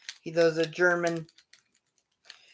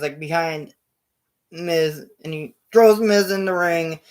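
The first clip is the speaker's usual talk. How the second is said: ordinary talk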